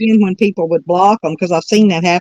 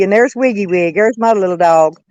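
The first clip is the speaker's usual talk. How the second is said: ordinary talk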